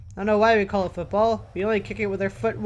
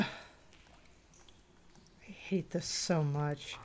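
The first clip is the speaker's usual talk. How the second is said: very slow, softer dread; reluctant, dragging complaint; dreading groan; 5 semitones lower, 12 dB quieter, much slower than usual. Real, not acted. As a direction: drag it out in misery. Dreading the sewer.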